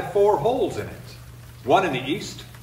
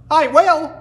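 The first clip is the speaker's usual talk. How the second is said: high, loud defiant snap; rattled defiance; 13 semitones higher, 9 dB louder than usual. Acted. Wren snaps back at the laughing people. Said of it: "rattled Marsh Wren".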